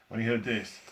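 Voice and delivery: usual speaking voice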